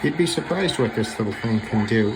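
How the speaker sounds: normal talking voice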